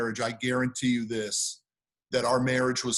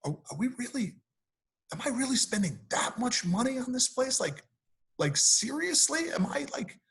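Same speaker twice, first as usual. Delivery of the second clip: inner doubting voice; 10 semitones higher, 4 dB quieter than usual. Retold or imitated. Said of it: "I was like".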